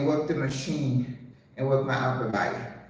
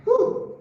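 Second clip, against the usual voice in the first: effortful whew; 4 dB louder than usual. Real, not acted. Exhaling hard mid exercise.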